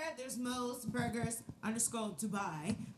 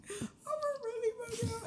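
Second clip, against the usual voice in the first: high, near-tearful emphasis; emotional, voice cracking; 11 semitones higher than usual. Real, not acted. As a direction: press it with proud emotion.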